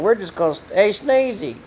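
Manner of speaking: lightly voiced truckers hailing Sneezy; truckers calling out. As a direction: call out the nickname over the radio. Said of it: "they said".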